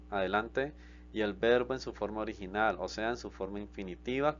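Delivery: usual speaking voice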